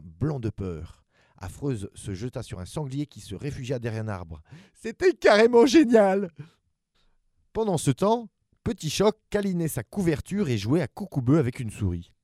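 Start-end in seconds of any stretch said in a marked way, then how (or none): 4.7-8.3 high, loud gleeful cry; thrilled. A narrator's reading. Narrator sharing the monsters' joy in the woods, thrilled. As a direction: burst out with pure delight. Kids thrilled playing terrors.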